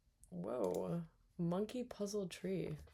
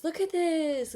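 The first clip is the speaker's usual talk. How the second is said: excited; 10 semitones higher, 7 dB louder, slower than usual. Real, not acted. Excited at cool park view.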